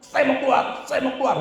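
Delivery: high-pitched cry of a demon giving in; retold screaming. Demon begging to come out, retold screaming. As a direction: imitate the possessed yelling in high pitch. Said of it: "dia mulai berteriak".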